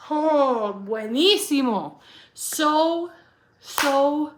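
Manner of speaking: loud, rapturous food praise; excited gushing. Real, not acted. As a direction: swoon over the taste. Gushing over the fish and chips.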